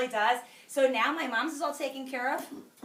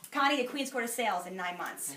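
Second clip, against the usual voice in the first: ordinary talk, nothing marked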